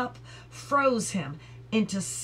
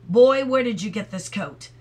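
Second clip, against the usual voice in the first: loud, sharp accusing question; stern demand; 8 dB louder than usual. A narrator's reading. Mister Light demands where the boy got the coat, loud and accusing.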